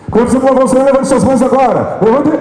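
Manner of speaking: high, loud call into the mic; overbearing animator shout. Shown as a demonstration. Trainer mimicking an animator commanding hands up, loud and high. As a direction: shout it out to rouse a crowd.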